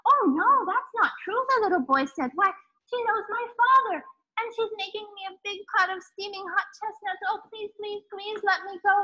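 put-on high, near-tearful begging boy; pleading little boy. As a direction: beg like a child who wants the treat. Boy begs priest.

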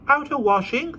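put-on high little boy voice; small boy. A narrator's reading. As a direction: answer like a small child. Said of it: "said the boy".

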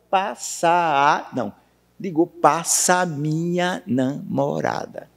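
the quadrinha "Por detrás daquela seca passa boi" stretched out slowly, syllable by syllable; scanning syllables. Shown as a demonstration. Teacher to class, slowly scanning the verse syllable by syllable. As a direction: count out each syllable of "passa boiada" slowly, with long pauses between words. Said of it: "A acentuação vai até a última sílaba tônica".

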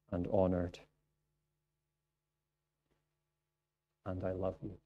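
God's promise read to the congregation, tender. Acted. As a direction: let it land gently and softly. Closing Isaiah reading tenderly.

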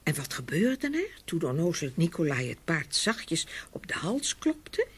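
ordinary delivery, nothing marked

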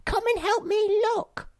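loud, very high monkey call; high-pitched creature shout; excited cry. Acted. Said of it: "she cried".

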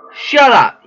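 acted angry cut-in, pitched high; high-pitched, irritated outburst; sharp interruption. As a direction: cut them off angrily, voice jumping up. Acted quarrel in game.

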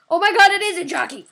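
excited shout. Real, not acted. Discovering jockey.

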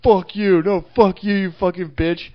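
hammed-up replay of a street fight; retold shouting match. Driver retelling a fight with the parking lady, mock shouting. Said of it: "we had this epic just like".

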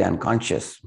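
usual speaking voice

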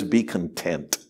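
a gentle hush from God; soft, still command; God's quiet voice. Retold or imitated. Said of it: "and God says".

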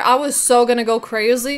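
normal talking voice

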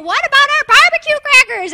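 put-on high, loud whiny kid voice; a little kid's high complaining voice; child voice. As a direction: squeal it like a five-year-old. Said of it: "your five-year-old is saying".

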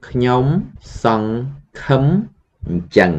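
ខ្ញុំ … សង្ … ឃឹម … អញ្ចឹង with pauses between each syllable; slow syllable by syllable. Shown as a demonstration. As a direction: spell it out slowly for the class. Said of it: "So the whole sentence".

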